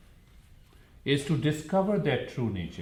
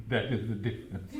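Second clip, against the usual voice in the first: laughing; 4 dB quieter, faster than usual. Real, not acted. Chuckling over his own stress remark.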